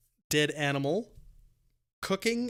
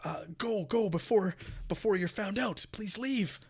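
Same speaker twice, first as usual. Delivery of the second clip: higher-pitched, stammered plea; pleading; 6 semitones higher than usual. Acted. Game master as frightened captives begging adventurers to leave, pleading. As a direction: beg the intruders to go, voice raised in pitch. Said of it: "they start, uh, pleading".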